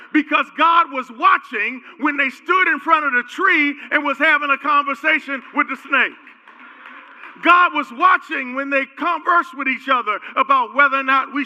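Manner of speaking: loud, high fervent preaching; full-force preaching. Acted. Pastor preaching to the congregation, loud fervent sermon. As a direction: drive it home to the church.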